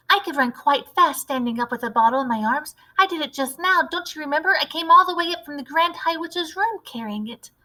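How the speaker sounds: put-on a keen little boy with a clever plan; eager mouse boy. Narrator voices mouse boy.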